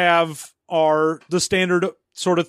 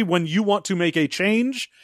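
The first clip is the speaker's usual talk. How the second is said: ordinary delivery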